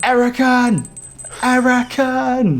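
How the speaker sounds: high, loud summoning call; yelling the name across the battlefield; shouting a name. Acted. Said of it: "and shout".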